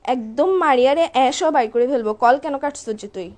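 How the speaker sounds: ordinary delivery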